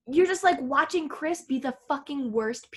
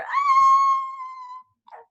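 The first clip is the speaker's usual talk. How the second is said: very high, drawn-out wail; mock anguished scream; 5 dB louder than usual. Reacting as a viewer to her heartbreak, high mock wail. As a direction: let out a long theatrical shriek.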